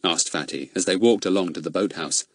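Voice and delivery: usual speaking voice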